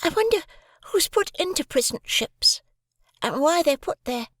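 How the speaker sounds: put-on very high boy's voice, wondering; a small boy wondering aloud, desperate; quiet desperation. A narrator's reading. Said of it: "with quiet desperation".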